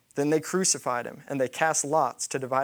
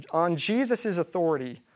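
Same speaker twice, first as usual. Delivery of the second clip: ordinary delivery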